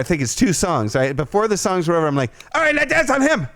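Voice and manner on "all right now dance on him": bossy commands. Retold or imitated. Drunk customer directing the stripper's dances, high bossy orders. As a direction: order her around like a drunk boss.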